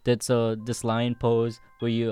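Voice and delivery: ordinary delivery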